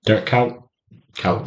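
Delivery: Dirk Kuyt, with Kuyt as KOWT; "ow" vowel in Kuyt. Shown as a demonstration. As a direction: say Kuyt to rhyme with "out". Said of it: "how people pronounce it".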